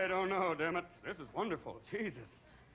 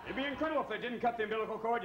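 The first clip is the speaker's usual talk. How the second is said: normal talking voice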